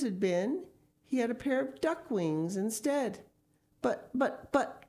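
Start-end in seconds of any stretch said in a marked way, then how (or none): 3.8-4.9 high, stammering dismay; wife stammering in alarm; cried out. Acted. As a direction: stumble out in shock as the wife. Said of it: "cried Missus Gregg".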